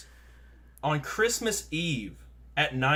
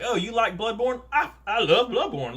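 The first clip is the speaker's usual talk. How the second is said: higher-pitched gamer enthusiasm; excited fan; 9 semitones higher, 4 dB louder than usual. Acted. Gamer chatting with a customer who likes Bloodborne, excited. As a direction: gush like you found a fellow fan. Fans bond over favorite game.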